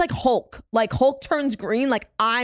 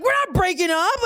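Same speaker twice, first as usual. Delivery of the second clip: higher-pitched protesting echo; retold indignant retort; 7 semitones higher than usual. Friend echoing Matt to the stranger, indignant. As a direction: protest it high, re-enacting the spat.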